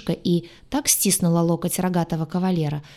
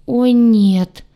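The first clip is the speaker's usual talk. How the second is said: loud, dismayed cry; higher, loud outburst of horror; yelling; 5 semitones higher, 9 dB louder than usual. A narrator's reading. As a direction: yell out in dismay. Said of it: "сразу заорал".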